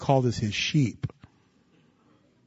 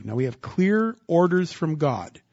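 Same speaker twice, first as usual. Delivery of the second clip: ordinary talk, nothing marked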